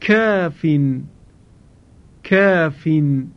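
کافین with a thin, light long آ; recitation demo. Shown as a demonstration. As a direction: model کافین with the vowel kept light. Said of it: "قرار گرفته".

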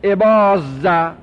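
ابازه said slowly, the middle z held long; slow classroom pronunciation demo; slow demo of the form. Shown as a demonstration. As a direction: draw out ابازه as the wrong form to avoid. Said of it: "اون وقت بگید".

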